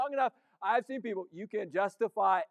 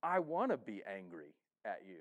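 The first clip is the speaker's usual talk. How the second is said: sulky grumble; 14 semitones lower, 9 dB quieter than usual. Acted. Voicing resentful sinner.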